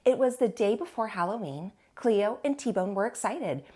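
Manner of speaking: plain reading voice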